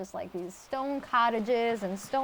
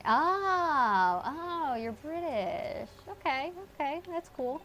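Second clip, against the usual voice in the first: rising oh, then slow you're British; dawning delight at the news; drawn-out surprised realization; pleased surprise; 7 semitones higher, slower than usual. Real, not acted.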